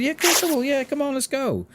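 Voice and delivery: very high, bright eager agreement; 1 eager yes. Retold or imitated. Streamer eagerly agreeing to guide the new player, very high. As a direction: pitch way up and sound thrilled to help.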